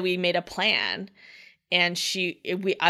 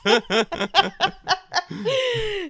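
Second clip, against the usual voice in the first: big laugh; 13 semitones higher than usual. Real, not acted. Laughing after retelling Amy.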